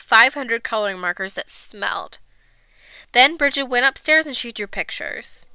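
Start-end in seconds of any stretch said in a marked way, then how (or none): none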